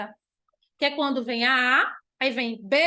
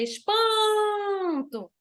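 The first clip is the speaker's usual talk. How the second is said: "espanto" com "pan" muito esticado; tônica no PAN; 6 semitones higher, much slower than usual. Shown as a demonstration. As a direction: alongue bem o meio de "espanto". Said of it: "O pan é mais forte". ("espanto": PAN forte e longo, "to" fraco)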